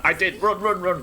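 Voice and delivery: loud, high panicked run yells; yelling while running away. Real, not acted. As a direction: shout run as you flee. Fleeing a monster in game.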